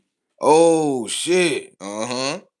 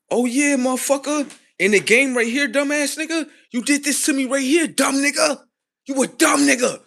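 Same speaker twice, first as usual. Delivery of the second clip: very high, loud taunting shout; vengeful gloating; 7 dB louder than usual. Acted. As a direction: scream it in his face, triumphant. Acted quote, victim gloating after waking.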